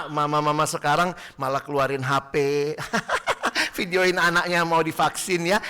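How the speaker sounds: ordinary talk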